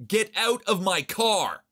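angry shout. A narrator's reading. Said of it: "So I shouted at the kid".

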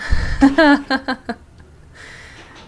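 loud, jittery burst of laughter; anxious giggle fit; nervous laugh. Real, not acted. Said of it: "that's my nervous laugh".

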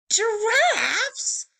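high, loud shocked shout; alarmed cry. Acted. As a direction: yelp it in shock. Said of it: "cried Mister Snore".

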